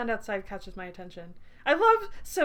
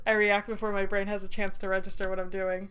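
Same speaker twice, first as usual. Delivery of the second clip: ordinary delivery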